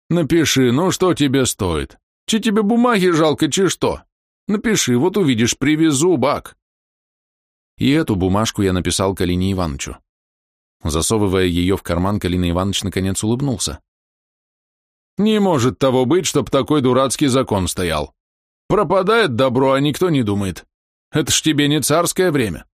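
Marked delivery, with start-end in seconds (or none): 0.1-6.6 high, slow nagging plea; wheedling. Acted. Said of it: "клянчил". 15.2-22.7 put-on indignant old man. Voicing Kalina Ivanovich.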